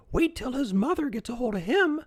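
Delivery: put-on a villager joking about the boy's mother; mocking villager. A narrator's reading. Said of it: "added yet another".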